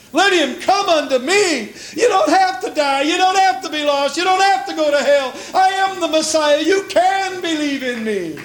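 high, lifted pleading call; emotional Jesus. Acted. Preacher voicing Jesus calling sinners, emotional plea. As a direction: plead with them as Jesus, full of feeling. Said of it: "just so emotional about it".

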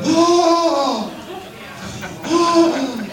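two high, long gasps of wonder; acted heavenly astonishment, breath caught twice; awed gasps. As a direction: gasp in endless amazement. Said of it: "we're gonna be going".

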